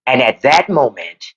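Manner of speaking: low, measured narrator imitation; Morgan Freeman. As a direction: narrate the downfall gravely. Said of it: "that's when Morgan Freeman says".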